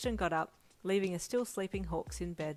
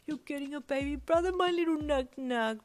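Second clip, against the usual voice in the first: very high, gushing squeal; squealing baby talk; 8 semitones higher, 5 dB louder than usual. A narrator's reading. Friend cuddles the baby with news, squealing. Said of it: "Brian squealed".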